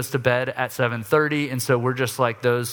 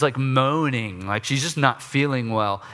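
ordinary delivery

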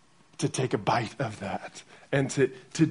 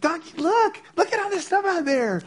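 put-on much higher, excited toddler voice; two-year-old son. Abel telling his stuffed dog to watch the rain, high and excited. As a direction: squeal like a little boy at the window. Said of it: "and he's like".

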